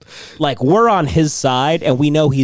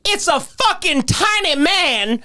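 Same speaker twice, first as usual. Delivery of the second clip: slow, high-pitched outraged imitation; mock yelling; 13 semitones higher, slower than usual. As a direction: rant like an irate passenger, stretching every word. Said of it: "He's yelling at them".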